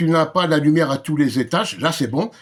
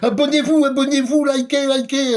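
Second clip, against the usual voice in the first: mock youtuber plea; 8 semitones higher than usual. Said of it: "c'est pas moi qui dois te dire".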